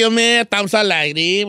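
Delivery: ordinary talk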